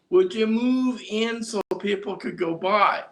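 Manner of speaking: lightly voiced flight attendant asking nicely; polite stewardess. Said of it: "said to this lady".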